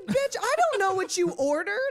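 loud, high-pitched indignant comeback; mad retort. Retold or imitated. Customer snapping back at drink thief, mock-mad and loud. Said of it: "I was so ma".

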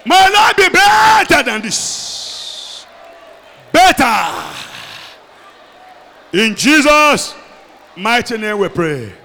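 shouted prayer, stretched and loud; preaching at full force. Acted. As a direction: pray it out at full force over the crowd.

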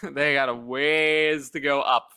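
"ways" stretched out slowly; drawn-out WAYS. Real, not acted. Podcaster on the climb up to Willis, drawn-out "ways". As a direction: drag out the word "ways" for emphasis. Stressing the big gap to Willis.

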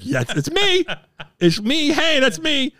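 high, laughing, thrilled; giddy fan. Retold or imitated. Fan recognized by Messier, high giddy reply. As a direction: beam it out like a kid noticed by a hero. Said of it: "And I'm like".

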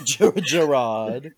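stretched, mocking "Gerard" with a false start; mock spelling pronunciation. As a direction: echo "Gerard" slowly, stressing the second syllable. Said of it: "Spelled in the most horrible way".

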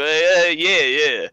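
light mock New York yeah; a hint of a Jersey grandpa; lightly imitated New York drawl on yeah; New York accent. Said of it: "he'll go".

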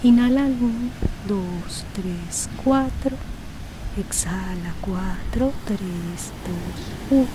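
soft, slow counting with whispered exhales; slow breath counting. Acted. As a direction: count each breath gently and unhurried.

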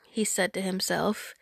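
usual speaking voice

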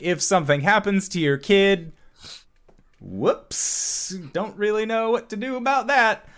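high, airy shrug of a voice; mock flippant disclaimer. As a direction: toss it off as a breezy oops. Mimics waiver's careless disclaimer.

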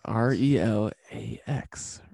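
"R E L A X" spelled letter by letter, slow. Shown as a demonstration. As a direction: spell "R E L A X" out slowly, each letter apart. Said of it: "A S M R".

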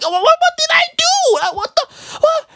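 high, stammering disbelief. Retold or imitated. Comic as rapper addressing [name], high baffled sputter. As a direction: sputter a squeaky mock protest. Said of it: "when he was addressing [name]".

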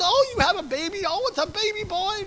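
high, loud mock elation; wildly excited. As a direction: imitate an overjoyed doctor announcing a birth. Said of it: "he was so excited".

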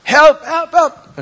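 loud, high, hammed-up yell of help; terrified disciples shouting for rescue; mock cry for help. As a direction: cry out like panicked fishermen. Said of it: "they cried out".

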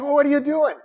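high, loud, pleading why-God; questioning God. Retold or imitated. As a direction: cry out in baffled protest at God. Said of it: "You question".

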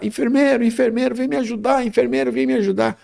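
high, strained plea for the nurse; imitating a patient's pained cry. Patient in pain begging the absent nurse, high strained call. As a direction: mimic a man in pain calling out, pitched high.

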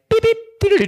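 high chirpy phone beeps; mimicked phone chime; imitated beep.

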